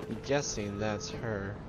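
ordinary talk

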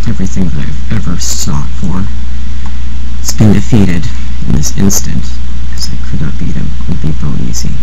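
hammed-up despairing bellow, low and strained; anguished yelling. Beaten gamer wailing that his career is over, in a mock-tragic bellow. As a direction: roar your ruined life to the heavens.

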